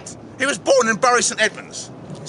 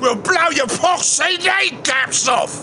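slow, drawn-out cockney hard man; mock cockney threat; 4 semitones higher, slower than usual. Host echoes friend's gangster threat, hammed-up cockney.

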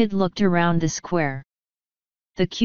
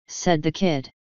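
ordinary delivery

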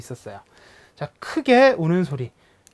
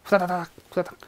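scurrying word demo; 2 semitones higher, 5 dB quieter, faster than usual. Shown as a demonstration. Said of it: "나타내는 낱말".

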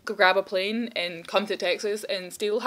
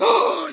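excited gasp; 13 semitones higher, 3 dB louder than usual. Real, not acted. Sudden idea.

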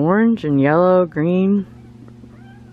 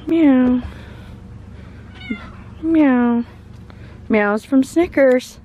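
imitated meow; 7 semitones higher, slower than usual. Meowing at the cat.

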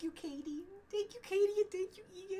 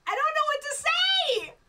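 high, loud happy outburst; overwhelmed; 9 semitones higher, 5 dB louder than usual. Real, not acted. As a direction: blurt it out, lost for words. Overwhelmed by viewers' support.

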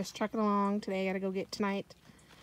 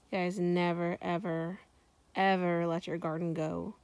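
ordinary delivery

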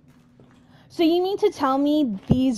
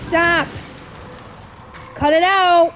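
loud, raised shouts; frantic angry yell; 5 semitones higher, 6 dB louder than usual. Real, not acted. Player under attack, yelling to make it stop.

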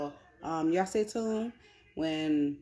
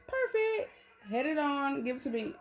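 gleeful; 9 semitones higher than usual. Real, not acted. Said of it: "gleeful grabbing of shoes".

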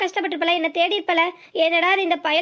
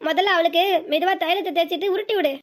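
ordinary talk, nothing marked

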